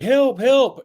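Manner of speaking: high, loud mock call for help; hammed-up little damsel cry. Woman in the cartoon calling for help, mock cry. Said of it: "she's like".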